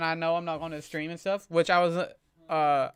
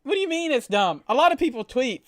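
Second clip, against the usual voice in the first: indignant; 8 semitones higher, 8 dB louder than usual. Real, not acted. Arguing back at chat.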